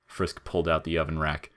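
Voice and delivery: plain reading voice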